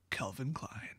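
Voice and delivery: breathy mock perfume ad hush; perfume ad whisper. Joking after Gideon's brooding line, in a perfume ad whisper. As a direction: whisper it like a cologne commercial.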